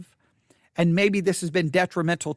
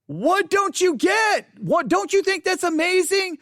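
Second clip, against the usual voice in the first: much higher, slow, incredulous plea; exasperated excitement; 11 semitones higher than usual. Retold or imitated. Pastor imitates himself begging the congregation to be amazed, much higher. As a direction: plead in disbelief at their blank faces. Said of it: "and you're like".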